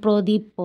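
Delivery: প্রদীপ প said in pieces, "pro-dip-po"; silent letter pronounced. Shown as a demonstration. Teacher repeating the wrong Bengali reading, প্রদীপ প with extra syllable. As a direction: add a spelled-out "po" after প্রদীপ প. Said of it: "বাংলাতে এইভাবে উচ্চারণ করি না".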